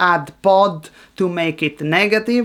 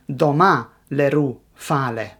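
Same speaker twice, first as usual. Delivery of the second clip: "Doma le ru fa le" stressed doMA, leRU, FAle; second-syllable stress in "Doma" and "le ru", first in "fa le"; "Doma le ru fa le" with only the last word front-stressed; mixed syllable stress. Shown as a demonstration. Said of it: "which phrase has the stress on".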